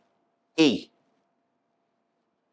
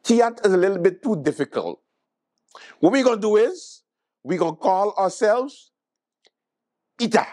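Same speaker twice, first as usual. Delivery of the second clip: higher-pitched pitch from whoever coined it; imagined official; 5 semitones higher than usual. Acted. Comedian mocking whoever picked the new name, imagined official. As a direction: act out someone proposing a bright idea. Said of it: "come up with this and was like".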